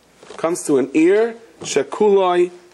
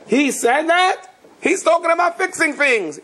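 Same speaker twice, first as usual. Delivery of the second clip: high-pitched mock disbelief; incredulous retort; 13 semitones higher than usual. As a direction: protest in disbelief, voice shooting up. Incredulous student answers his teacher.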